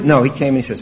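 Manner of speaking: ordinary talk, nothing marked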